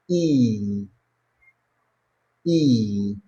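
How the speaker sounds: "E" held as one long steady vowel. Shown as a demonstration. Teacher modelling long "E" for students, held steady. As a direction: stretch "E" out without gliding. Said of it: "and it's pronounced".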